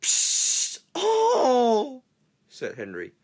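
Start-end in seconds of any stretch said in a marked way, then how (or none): 0.0-2.0 hissing burst then high cry; steam hiss. Acted. Engine spluttering at the boys, hissing.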